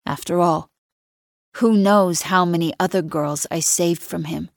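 ordinary delivery

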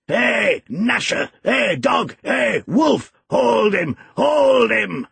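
high, urgent shout to set the dogs on; high-pitched yelling, stretching the last cry; shouting old servant. A narrator's reading. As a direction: holler commands at the dogs. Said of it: "shouted the ancient".

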